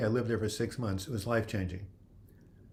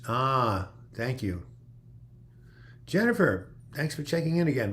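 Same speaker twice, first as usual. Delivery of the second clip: ordinary talk, nothing marked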